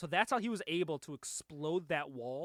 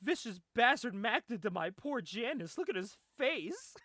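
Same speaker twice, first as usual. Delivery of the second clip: high-pitched mock distress; outraged fussing; 7 semitones higher than usual. Retelling a friend's outrage over poor Janice, mock distressed. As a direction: wail over it in a high imitation voice, slowing at the end.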